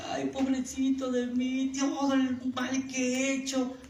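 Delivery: high, slow, whiny lament; hammed-up sorrow, drawn out; mock self-pitying wail. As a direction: put on a weepy "woe is me" voice. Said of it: "solo estamos llorando por nuestro egoísmo".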